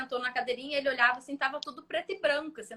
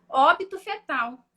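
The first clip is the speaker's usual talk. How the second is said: blunt; 8 dB louder, slower than usual. Retold or imitated. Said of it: "Dessa forma".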